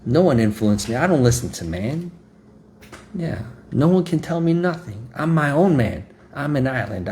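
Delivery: high-pitched mock defiance; proud boasting. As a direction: act out a man puffed up with pride. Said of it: "there's a pride of man that says".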